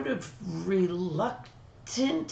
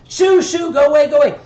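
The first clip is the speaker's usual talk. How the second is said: shooing a roach; 7 semitones higher, 10 dB louder, faster than usual. Retold or imitated. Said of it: "Okay, uh".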